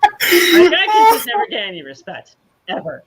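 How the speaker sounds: high, loud mock wail; mock crying. Fan lamenting a wrestler's bad luck, hammed-up crying wail. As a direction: whine it like a hammed-up sob.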